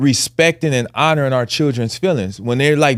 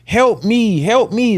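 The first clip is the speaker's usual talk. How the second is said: higher voiced little plea for help; a small child begging to be let go, pitched up; child pleading; 6 semitones higher, slower than usual. Toddler begging her father for help, mock plea pitched up. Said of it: "she's like".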